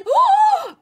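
loud squealed gasp; shrieky thrilled intake; excited gasp. Real, not acted. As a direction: gasp high and loud with delight. Excited gasp while shopping.

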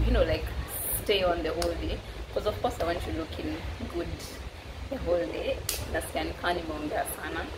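ordinary talk

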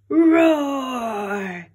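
big, loud, high dinosaur roar; T-Rex roar. Acted. T-Rex writing back to scare Max, loud monster roar. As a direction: roar it out like a terrifying king of the dinosaurs.

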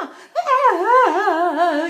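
high, loud mock wail; hammed-up drawn-out howl, falling gradually; scandalous wailing scream. Upset woman makes a scene in a bar, wailing.

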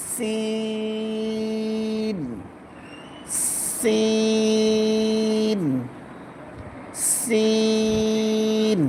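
each সিন with whistling s and drawn-out ee; long sin. Shown as a demonstration. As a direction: chant সিন slowly on a level note. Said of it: "লম্বা হবে". (সিন লম্বা টেনে)

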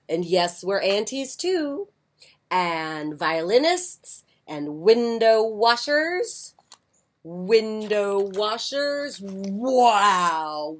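lively picture-book read-aloud; bright storytime reading; reading to children. A narrator's reading.

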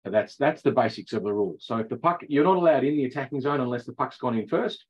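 ordinary delivery

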